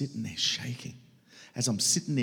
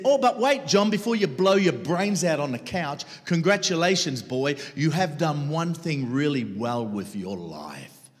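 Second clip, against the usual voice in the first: loud, much higher, mocking congratulations; condemning voice; 7 semitones higher, 9 dB louder than usual. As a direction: sneer it like a voice out to crush him. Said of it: "condemning voice that said to me".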